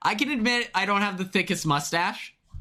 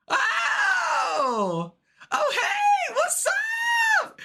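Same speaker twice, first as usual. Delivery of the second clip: excited greeting shout; much slower than usual. Real, not acted. Spotting a friend and squealing an excited hello. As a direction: squeal a greeting across the room.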